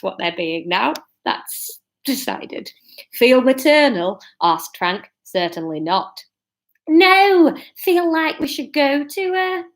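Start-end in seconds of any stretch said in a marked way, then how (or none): 3.1-4.2 higher, slow northern question; broad northern. Acted. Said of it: "we're going broad strokes northern". 5.2-6.2 low northern reply; broad northern. Acted. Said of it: "we're going broad strokes northern". 6.9-9.6 high, slow northern voice; broad northern. Acted. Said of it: "we're going broad strokes northern".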